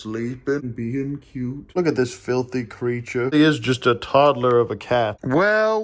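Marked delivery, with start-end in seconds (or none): 5.2-5.8 mock surprise. Real, not acted. Spotting the cat finally eating.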